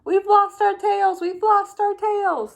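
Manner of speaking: high, loud cheer. Acted. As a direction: shout it like a happy crowd of tadpoles. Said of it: "they cheered".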